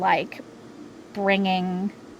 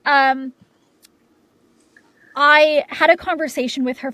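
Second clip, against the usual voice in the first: fighting back tears; 5 semitones higher, 6 dB louder than usual. Real, not acted. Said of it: "I'm gonna get very emotional".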